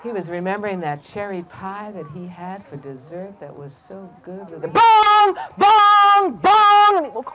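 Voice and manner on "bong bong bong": loud, high, held bongs; booming clock strikes, drawn out; imitated clock chime. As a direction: ring out each stroke like a clock.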